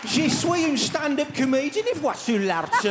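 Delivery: high, broad Brummie French; Brummie accent. Shown as a demonstration. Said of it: "French with a Brummie accent".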